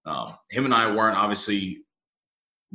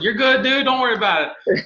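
way up high and louder, brushing it off; high reassuring reply; 14 semitones higher, 7 dB louder than usual. Retold or imitated. Reassuring his friend about the missed calls, high and bright. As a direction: squeak out an easy no-worries.

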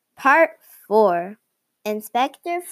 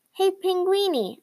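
calling out; 8 semitones higher than usual. A narrator's reading. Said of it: "I called".

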